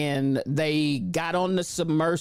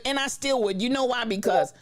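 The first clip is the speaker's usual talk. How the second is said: ordinary talk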